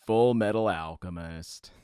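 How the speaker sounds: low, slow announcer voice. Shown as a demonstration. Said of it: "I'm gonna do the announcer voice".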